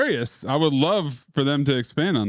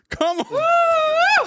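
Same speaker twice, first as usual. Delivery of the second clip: laughing shout. Real, not acted.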